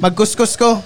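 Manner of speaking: put-on Bisaya accent. Drunk uncle asking for scratch cards, Bisaya accent. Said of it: "may tito rin akong Bisaya".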